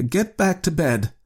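put-on slow, high-pitched uneasy old man; unsteady. A narrator's reading. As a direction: try to order your wife back, rattled. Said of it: "he said unsteadily".

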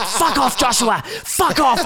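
high, fed-up mock dismissal; annoyed mum. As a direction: brush him off and hang up. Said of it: "And she's like".

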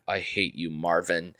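a hint of a cold, quiet hatred; low, soft and cutting; low quiet movie line. To the dog, a low flat hint of menace. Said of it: "just the way he, like, said".

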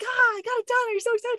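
high, gushing retold excitement; mock excitement. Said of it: "be really excited".

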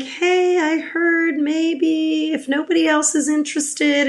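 high, hesitant sheepish ask. Retold or imitated. Asking a coworker about spare concert tickets, sheepish. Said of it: "I sort of sheepishly said".

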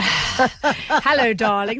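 sultry drawn-out hello darling; femme fatale. Pretend. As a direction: purr it like a glamorous diva. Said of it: "That's how I should have greeted you".

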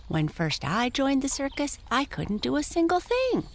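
high pony voice; talking pony. Acted. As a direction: confide like a humble pony. Prancer voice.

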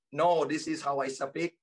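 put-on English with a heavy Thai accent; thick Thai accent. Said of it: "I would speak like this all the time".

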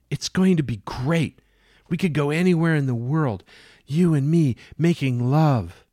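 a mad woman serving the captain breakfast; Jane. Acted. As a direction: play her as doting and unhinged. Jane voiced as a character.

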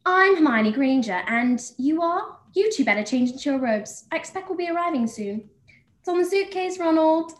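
high-pitched English schoolgirl, emphatic and precise; Hermione Granger impression. Shown as a demonstration. Actress performs her requested Hermione Granger party piece for the interviewer, Hermione impression. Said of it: "wants me to do the Hermione Granger impression".

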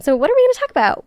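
high, rushed question; quick playful question. Real, not acted. Host teasing today's episode to listeners, quick playful question. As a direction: rattle it off high and fast.

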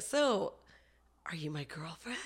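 put-on boyfriend's question in a soft whisper; breathless whispered "So, are you my girlfriend?"; hushed retelling. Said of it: "And he's like".

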